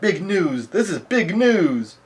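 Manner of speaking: high, swooping mock shout without real volume; Morgan's excited cry. Reporter yelling out his scoop, hammed-up excited cry. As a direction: herald the news, stretching the last word. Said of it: "Morgan yells out".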